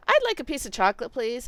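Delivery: fast, with the a swallowed into "I'd like a piece"; quick reduced "a". Shown as a demonstration. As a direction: rush it so "a" barely sounds. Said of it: "I just said "a".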